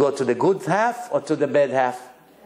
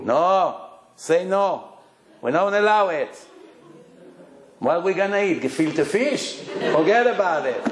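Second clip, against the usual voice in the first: parents forbidding the match, a bit higher and brighter; mock the strict parents refusing an outsider; threatening parents; 3 semitones higher than usual. Parents refusing a match from another culture, mock indignant. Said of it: "right away they're threatening you".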